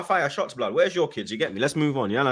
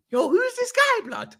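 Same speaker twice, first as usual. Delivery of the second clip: squeaky high-pitched shock; surprised; slower than usual. Retold or imitated. Recalling first seeing Camavinga, high amazed shout. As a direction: voice jumps way up in amazement. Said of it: "Did you speak in that tone as well?".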